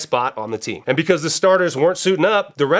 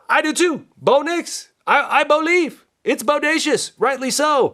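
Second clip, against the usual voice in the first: much higher, giddy fan enthusiasm; playful fan hype; 10 semitones higher than usual. Acted. Host admits he gets caught up in quarterback chatter, playfully hyped. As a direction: gush high-pitched like a starstruck fan.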